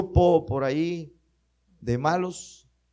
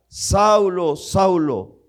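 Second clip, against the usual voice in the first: calling out; 3 semitones higher, 6 dB louder, much slower than usual. Acted. Voice from heaven calls Paul.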